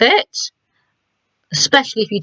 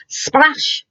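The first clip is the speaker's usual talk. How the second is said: sudden splash; 7 semitones higher than usual. Shown as a demonstration. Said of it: "all of a sudden".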